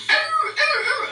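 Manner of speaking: high-pitched mock dog howl; imitated hound howling. Retelling the dog going off as she left the shower, imitated howl. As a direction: mimic an excited hound's high cry. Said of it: "Silas was going".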